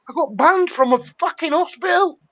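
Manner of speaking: high, indignant retelling; indignant disbelief. Real, not acted. Telling mate about hospital ban, high indignant tone. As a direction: sound outraged at being banned.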